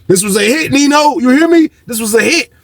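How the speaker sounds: high-pitched, insistent mock outcry; dramatic quoted accusation. Inmate dramatizes the targeted search to Nino, high insistence. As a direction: raise the pitch and press the point.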